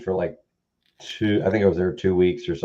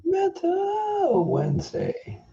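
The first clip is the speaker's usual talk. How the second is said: high drawn-out call; 7 dB quieter, much slower than usual. Real, not acted. Echoes the metal sign-off.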